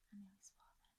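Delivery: hushed, unvoiced aside; sheepish whisper. Real, not acted. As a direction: whisper it as if caught out. Catching herself nearly swearing.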